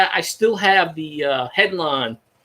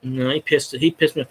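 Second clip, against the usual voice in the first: ordinary talk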